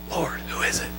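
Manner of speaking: hushed, breathless whisper; whispered question. Acted. Disciple whispering his question to Jesus. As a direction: breathe it like a secret.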